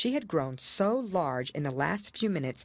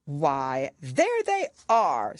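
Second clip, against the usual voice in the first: triumphant king; 4 dB louder, much slower than usual. A narrator's reading. King pointing at the tarts, triumphant. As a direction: crow it triumphantly. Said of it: "said the king triumphantly".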